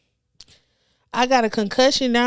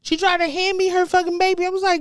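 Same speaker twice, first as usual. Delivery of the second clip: ordinary talk, nothing marked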